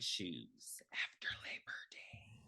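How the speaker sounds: slow, hushed mock horror; scandalized whisper. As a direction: whisper it slowly as if unspeakable. Mock horror at fashion crime.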